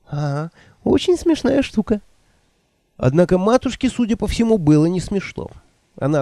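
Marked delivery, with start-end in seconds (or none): 0.1-2.0 put-on higher, fluttery old woman voice. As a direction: play the flustered old witch. Nanny voiced by narrator.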